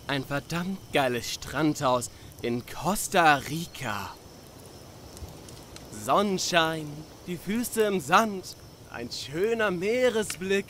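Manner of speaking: slow, swelling excitement, savouring each image; enthusiastic vision. Acted. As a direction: sell the dream with rising relish. Paints his dream beach house.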